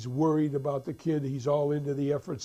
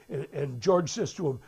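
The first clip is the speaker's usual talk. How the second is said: ordinary delivery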